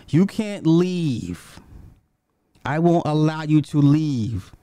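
slow, drawn-out imitation of a sobbing woman, lightly done; mock bawling plea. Quoting his girlfriend blocking the door, a hammed-up tearful plea. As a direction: stretch the words like a half-hearted sob. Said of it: "is bawling her eyes out".

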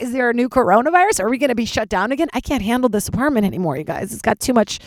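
normal talking voice

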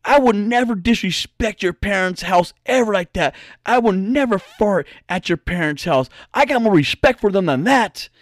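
a hint of an offended older man; lightly acted huffy denial; older guy insisting on respect, mildly indignant; retold indignation. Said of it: "he gets pissed off and mad".

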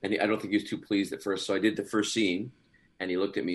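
ordinary talk, nothing marked

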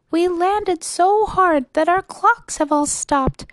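high, put-on voice of a troubled little mayor; high little voice, drawing out the complaints; tiny mayor's voice. A narrator's reading. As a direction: pour out your troubles from a tiny speck. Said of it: "came the voice of the mayor".

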